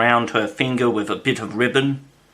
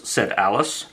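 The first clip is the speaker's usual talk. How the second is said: ordinary delivery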